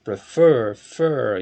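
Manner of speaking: Prefer stressed on the final "fer", then that syllable alone; stress on second syllable. Shown as a demonstration. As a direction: demonstrate Prefer with a strong second syllable, then isolate it as "fur". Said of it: "a tônica é a segunda".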